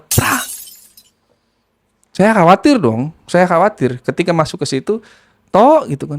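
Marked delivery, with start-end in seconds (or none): 0.0-0.4 high, sharp "Prak!" of breaking glass; imitated glass smash. Sound of glass breaking inside the nephew's house, high and sharp. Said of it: "suara pecahan kaca". 5.5-5.9 high, loud knock sound; imitated knock. Aunt knocking on the nephew's door, loud and high.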